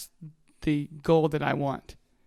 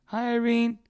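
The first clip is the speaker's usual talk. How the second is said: high sing-song greeting; 7 semitones higher than usual. Retold or imitated. Greeting replayed.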